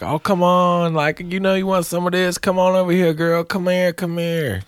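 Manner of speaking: put-on high, sleazy drunk come-on; drunk creep. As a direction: coax her over like a slurring barfly. Drunk man keeps coaxing her.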